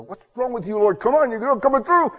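high-pitched, strained whine to God; hammed-up fed-up prayer; exasperated complaint. Speaker acts out complaining to God.

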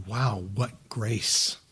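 slow, soft wonder; a believer, quietly awed by grace; hushed awe. Retold or imitated. Awed reaction quote.

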